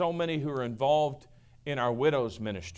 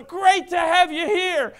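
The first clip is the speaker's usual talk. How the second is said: much higher, louder greetings across a parking lot; hearty yelling greeting; 13 semitones higher, 9 dB louder, slower than usual. Retold or imitated. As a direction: call out to everyone like an overly outgoing pastor. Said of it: "practically yelling at people".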